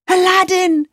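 high joyful call; joyful cry. Acted. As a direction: cry his name with joy. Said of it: "she cried".

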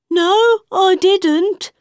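high, slow hare; high quick-tempered hare; March Hare voice. A narrator's reading.

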